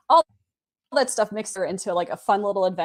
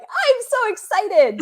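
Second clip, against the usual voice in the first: very high, squeaky excited cartoon voice; drawn-out, high-pitched bubbly character squeal; Pinkie Pie; 14 semitones higher, 6 dB louder, slower than usual. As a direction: squeal it slowly and loudly, bursting with excitement. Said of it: "this Pinkie Pie voice in my head".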